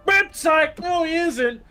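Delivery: hammed-up gotcha reveal; mock taunt. The game taunting players about Shadow, high mock teasing. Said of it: "they just go".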